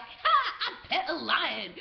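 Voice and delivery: high, loud crow of victory; triumphant gloating. Retold or imitated. Gloating after fooling the guards, triumphant. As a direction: crow it slowly and loudly, gloating.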